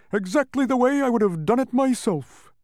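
high, loud, self-satisfied joviality. A narrator's reading. Humbug taking credit for Milo's win, jovial. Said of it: "commented the humbug jovially".

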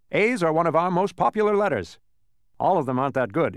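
bright, eager shopkeeper voice; letterman voice. A narrator's reading. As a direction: play the cheerful letterman. Letterman character voiced in story.

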